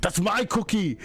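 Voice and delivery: put-on squeaky child claiming his cookie; kindergarten cop. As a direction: whine it like a kid in kindergarten cop. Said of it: "channeling kindergarten cop here".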